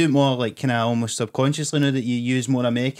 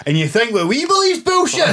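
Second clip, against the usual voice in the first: much higher, louder mock outrage; indignant Christians; 13 semitones higher, 5 dB louder than usual. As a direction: act out offended believers, high and incredulous. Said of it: "all those Christians that you've pissed off".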